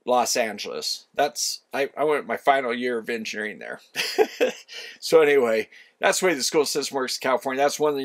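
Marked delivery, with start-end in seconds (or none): 3.8-4.5 brief breathy chuckle at own aside; short chuckle. Real, not acted. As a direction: chuckle lightly to yourself.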